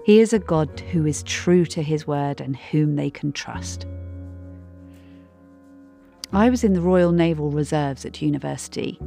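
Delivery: ordinary talk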